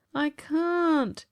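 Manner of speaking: high, thin plaintive ghost; a weak ghost pleading he is unable. Acted. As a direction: whine it like a feeble ghost. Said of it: "his thin voice".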